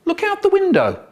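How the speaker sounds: very high, bright sing-song; forced excitement. Retold or imitated. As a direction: put on fake thrill for a child. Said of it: "Mum tries to be exciting".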